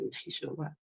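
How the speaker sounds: hushed, breathless whisper of disbelief. Retold or imitated. Customer whispering back to the store clerk in shock. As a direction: barely voice the word in shock.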